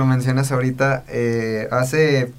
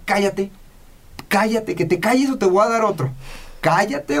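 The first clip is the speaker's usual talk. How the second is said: mock scolding, a little higher; imitating an angry father; 7 semitones higher than usual. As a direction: hush the crying child with a threat. Padre gritando al niño que llora. (padre enojado imitado, más agudo)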